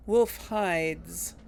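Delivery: normal talking voice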